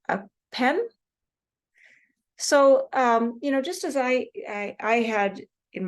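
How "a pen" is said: PEN with clear 'eh', rising as a question; questioning correction. Shown as a demonstration. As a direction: query back "a PEN?" with a distinct 'eh' and rising pitch. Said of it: "And I'd say".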